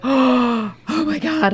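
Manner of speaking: gasp, then high drawn-out swoon; mock swooning. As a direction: imitate a smitten girl's breathless gasp. Mom swooning over photo.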